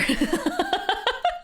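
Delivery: high, climbing giggle; helpless laugh. Real, not acted. Streamer realizing the guess is impossible, high helpless laugh. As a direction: burst into high-pitched laughter.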